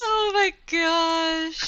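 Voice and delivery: slow, high, drawn-out horror; horrified. Real, not acted. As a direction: stretch it out in disgust. Disgusted reaction to gross idea.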